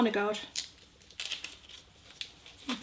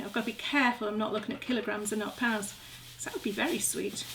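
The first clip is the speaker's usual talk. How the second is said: usual speaking voice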